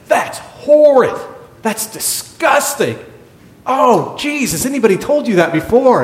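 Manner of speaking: high, slow, hammed-up revulsion; disgusted father. Father scolding the chaplain for bad breath, mock disgust. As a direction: act appalled, drag out each complaint.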